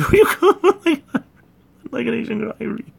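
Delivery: high squeaky laugh through words. Real, not acted. Laughing while speaking.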